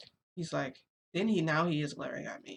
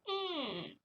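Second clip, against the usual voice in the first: high squeaky creak hummed through closed lips; creaky door imitation; 13 semitones higher, 3 dB quieter than usual. Showing how the door cracked open, a high creaky hum. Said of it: "It, like, cracks like that".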